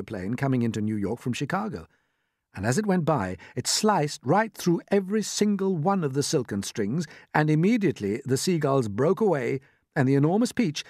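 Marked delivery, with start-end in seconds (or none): none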